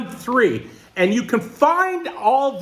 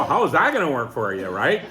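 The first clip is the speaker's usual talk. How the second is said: normal talking voice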